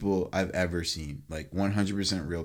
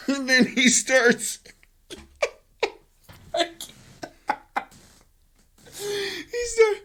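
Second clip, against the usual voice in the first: very high, laughing squeal; really cracking up, out of breath; breathless laughter; much slower than usual. Real, not acted. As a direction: fight to speak through helpless laughter. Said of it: "let me get my breath".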